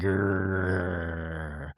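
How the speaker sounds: long, low growl; pissed-off growl. Retold or imitated. Castmate silently fuming at Whitney, mock angry growl. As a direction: imitate a low, soft simmering grr. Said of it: "Meredith looks pissed".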